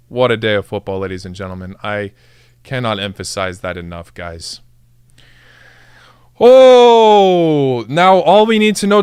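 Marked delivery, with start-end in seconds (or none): 0.1-4.6 low, soft, trailing delivery; hushed awe. Real, not acted. Streamer to viewers after a freaking day of football, hushed awe. Awed, spent after the celebration. 6.4-7.9 drawn-out, falling holler; long exultant "oh". Real, not acted. Streamer overwhelmed by the qualifiers, long exultant holler. Exhales loudly after the excitement.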